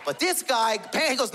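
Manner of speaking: ordinary talk, nothing marked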